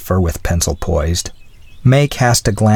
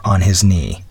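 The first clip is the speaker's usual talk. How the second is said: usual speaking voice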